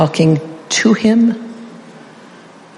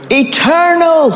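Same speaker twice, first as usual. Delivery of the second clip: much higher, begging, near tears; Jesus pleading; 10 semitones higher, slower than usual. Acted. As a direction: beg the Father for a reason, voice straining high. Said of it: "here's a little bit of my interpretation".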